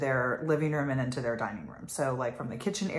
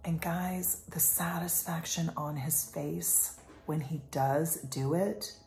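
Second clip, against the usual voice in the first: hushed, unvoiced aside; whisper; 7 dB quieter, slower than usual. Real, not acted. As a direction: lean in and whisper it. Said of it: "unvoiced whisper".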